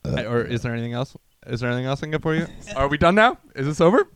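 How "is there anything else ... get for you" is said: rushed mock service line; fast cashier patter. Cashier racing through the order, rattled off fast.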